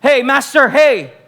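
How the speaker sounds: high, loud, slow mocking accusers; mock Pharisees. Pharisees accusing the woman before Jesus, mock high and loud. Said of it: "sabi nila".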